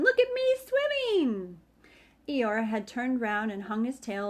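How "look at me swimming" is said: high squeaky little voice; much higher proud squeak; squeaky Roo. A narrator's reading. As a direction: squeak it like a tiny joey. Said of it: "was answering".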